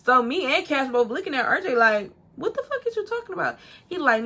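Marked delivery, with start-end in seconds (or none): 2.4-3.6 high, fast incredulous question; incredulous retort. Retold or imitated. Two friends confronting RJ in disbelief, high and incredulous. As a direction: shoot back a high-pitched, rapid challenge.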